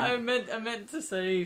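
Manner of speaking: high, slow, laughing correction. Real, not acted. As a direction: laugh through the words. Laughing at own slip.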